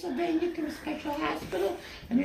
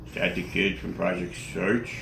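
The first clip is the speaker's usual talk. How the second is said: very low questioning voice; deep-voiced friend. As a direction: ask it low and calm. Anchor asks Gail.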